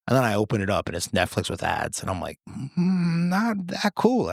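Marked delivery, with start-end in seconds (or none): 2.5-4.3 high, slow, drawn-out letdown; mock hesitant disappointment. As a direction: draw it out in a high, doubtful voice. Acted reaction to getting ads.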